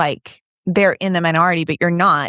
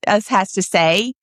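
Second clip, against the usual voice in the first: usual speaking voice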